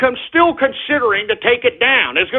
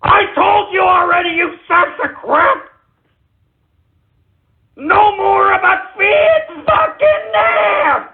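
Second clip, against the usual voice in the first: furious shout; 7 semitones higher, slower than usual. Real, not acted. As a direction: roar the warning at the trolls. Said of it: "I got a little angry".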